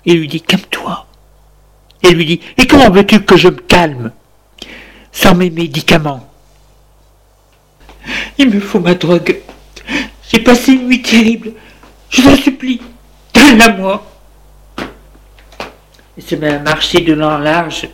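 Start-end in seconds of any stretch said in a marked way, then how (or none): 0.4-1.1 soft unvoiced hush; soft whispered plea to calm down. Husband calming wife. 2.6-14.0 high, pleading addicted wife; high desperate plea of an addicted wife; desperate begging. Acted.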